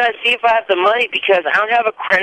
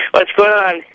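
over a landline phone. Real, not acted. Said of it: "Why do you sound different?".

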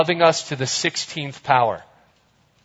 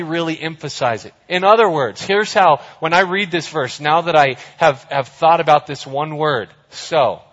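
normal talking voice

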